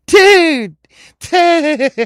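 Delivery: high, loud cackling "dude"; hammed-up re-enacted hysterics; kids cracking up. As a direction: burst out laughing like boys in disbelief. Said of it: "me and my buddy were just like".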